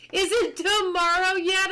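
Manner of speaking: ordinary delivery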